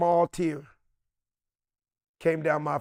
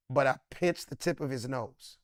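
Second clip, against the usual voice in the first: ordinary delivery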